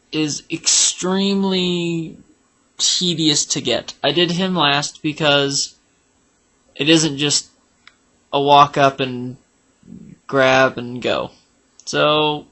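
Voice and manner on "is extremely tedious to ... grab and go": slow emphatic complaint. Real, not acted.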